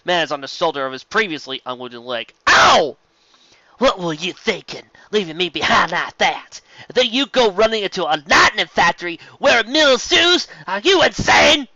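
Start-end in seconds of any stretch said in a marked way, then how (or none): 2.5-3.0 high, loud cry of pain; sudden loud ow; pained yelp. Acted. Struck hard on the shoulder. 3.8-11.7 rising furious telling-off; angry scolding. Acted. Scolding him after the punch.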